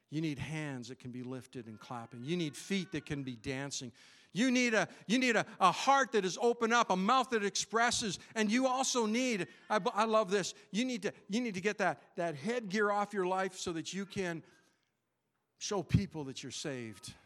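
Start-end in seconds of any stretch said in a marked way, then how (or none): 4.3-12.0 higher, louder preacher pressing the point; fervent preaching. Acted. Pastor urging church to open up, fervent preaching. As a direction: push it out with preaching fire.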